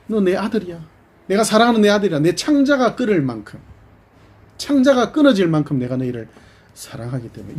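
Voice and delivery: God telling his rebellious son he still loves him; voicing God. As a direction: declare your love like a father. God answering his people.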